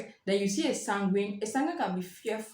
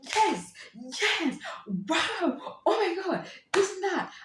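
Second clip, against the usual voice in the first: high-pitched, louder squeals of joy; mock choleric overjoyed at good news; thrilled outburst; 11 semitones higher, 3 dB louder, much slower than usual. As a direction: gush like good news just landed. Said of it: "they'll be like".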